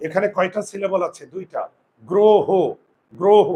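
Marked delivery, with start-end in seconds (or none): 2.0-3.5 গ্রহ loud in two even beats, "gro-ho"; jaw demonstration. Shown as a demonstration. Teacher showing the jaw stays shut, গ্রহ loud as "gro-ho". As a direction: punch out গ্রহ with closed, rounded vowels. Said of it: "আমার চোয়াল খুলে না".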